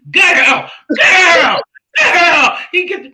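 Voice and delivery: high, loud hammed-up yelling at the screen; imitating Uncle Tim's reaction. Friend imitating Uncle Tim reacting, high loud yell. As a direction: mimic a friend's over-the-top shouted reactions. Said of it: "Uncle Tim when he does reactions".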